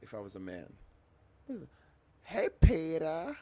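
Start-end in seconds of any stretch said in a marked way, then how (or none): 2.2-3.3 high, loud, slow cartoon housewife call; Lois from Family Guy. Streamer imitating Lois from Family Guy calling her husband, high and slow. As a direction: stretch out a shrill cartoon-wife greeting, much higher and louder. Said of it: "I sound like literally Lois from Family Guy".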